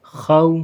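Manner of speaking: "خو" with a heavy, full kh; thick kha. Shown as a demonstration. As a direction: make the kh in "خو" thick. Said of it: "اس کو موٹا کرنا ہے".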